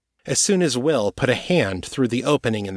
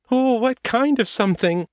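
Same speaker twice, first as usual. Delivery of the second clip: very high, frightened voice; fearful, octave up; 13 semitones higher, 3 dB louder than usual. A narrator's reading. Will asking Morgan what is coming, high with fear. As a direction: ask it in a high panicky pitch. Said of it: "fear driving his voice up an octave".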